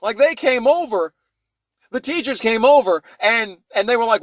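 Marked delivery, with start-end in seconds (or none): none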